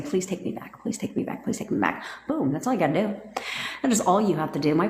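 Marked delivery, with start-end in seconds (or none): 0.0-2.0 repeated hushed plea fading to whisper; soft begging. Acted. Imagined ex begging to come back, soft begging. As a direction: murmur it like a voice in the head. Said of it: "the sound of his voice saying".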